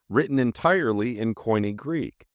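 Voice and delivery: ordinary talk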